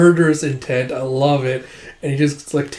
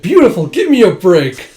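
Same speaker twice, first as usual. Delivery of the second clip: loud, raised-pitch scoff; retold exasperated exclamation; acted exasperation; 6 semitones higher, 9 dB louder than usual. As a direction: scoff it out in mock disbelief. Retold scoffing at beauty remark.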